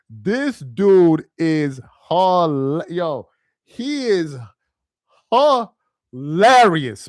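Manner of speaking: HILARIOUS stretched very slowly, broken after the first syllable; drawn-out emphasis. Real, not acted. Streamer raving about a funny YouTuber, slow drawn-out emphasis on "hilarious". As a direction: drag out "hilarious", punching the middle. Stressing how funny someone is.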